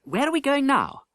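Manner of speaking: put-on high, curious boy's voice; young prince. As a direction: ask Miles eagerly. Edward's voice.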